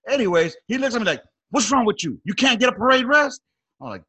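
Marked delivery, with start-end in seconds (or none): 1.5-3.4 loud, high-pitched incredulous demand; indignant challenge. Retold or imitated. Superior confronting a smoker about parade rest, indignant. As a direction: challenge him, voice jumping way up.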